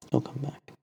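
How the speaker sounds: a hushed echo of firm faith; quiet repeat. Acted. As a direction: say it again very softly to yourself. Abu Dharr repeats, to himself.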